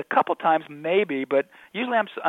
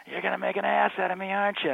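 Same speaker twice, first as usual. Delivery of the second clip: imitating a tipsy woman, pitched up; higher-voiced mock drunken accusation; drunk lady; 6 semitones higher than usual. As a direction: whine it like a drunk audience member. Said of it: "she's just drunk on stage".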